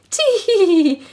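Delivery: a tiny mouse giggle; high squeaky giggle; giggling mouse. A narrator's reading. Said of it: "she giggled to herself".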